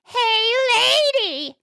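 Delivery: high parrot call, broken in two; squawking parrot. Acted. Parrot calling to the lady next day, squawking. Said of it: "and the parrot says".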